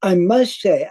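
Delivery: usual speaking voice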